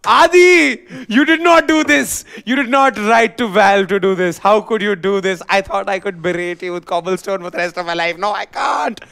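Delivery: high, loud mock outrage; outraged shout. Real, not acted. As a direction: wail at him in disbelief. Mock outrage at cohost's news.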